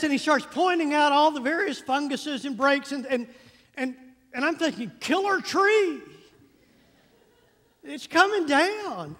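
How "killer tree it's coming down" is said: mock alarm. Panicked thought about the tree.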